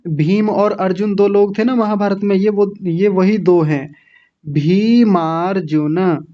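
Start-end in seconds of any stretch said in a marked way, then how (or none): 4.4-6.2 भीमार्जुन slowly as bhee-maar-ju-na; joined word, drawn out. Shown as a demonstration. Teacher models the joined name, slow भीमार्जुन as bhee-maar-ju-na. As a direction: stretch भीमार्जुन with long ee and aa. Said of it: "भीम अर्जुन नहीं बोलेंगे".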